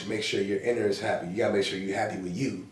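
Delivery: normal talking voice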